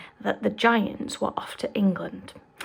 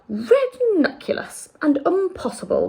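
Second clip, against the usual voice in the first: giant's dismissive voice, lightly higher; BFG voice; 8 semitones higher, 4 dB louder than usual. Acted. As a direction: brush it off as the giant. BFG character voice.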